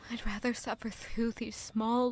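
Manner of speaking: usual speaking voice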